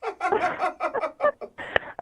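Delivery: high giggling laugh; burst of laughter. Real, not acted. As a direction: laugh in surprise, pitched high. Amused by the theory.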